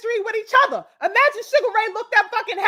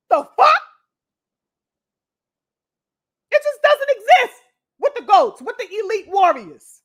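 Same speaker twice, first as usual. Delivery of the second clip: indignant; 3 semitones higher, 3 dB louder than usual. Real, not acted. Vlogger, outraged at a boxer's sass, indignant. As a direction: flare up in disbelief.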